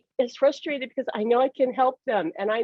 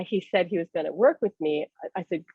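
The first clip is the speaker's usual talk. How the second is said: ordinary delivery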